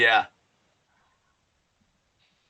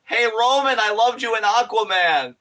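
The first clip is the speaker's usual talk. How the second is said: a fan yelling from the crowd, higher and louder; fan calling out; 8 semitones higher, 5 dB louder than usual. Retold or imitated. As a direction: shout it across the arena at Roman.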